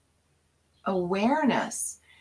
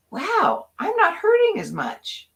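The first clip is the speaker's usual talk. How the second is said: much higher, surprised wonder; light up at the discovery; high, delighted surprise; amazed realization; 12 semitones higher, 3 dB louder than usual. Retold or imitated. Retold moment of realization.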